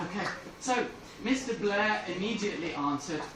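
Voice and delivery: ordinary talk, nothing marked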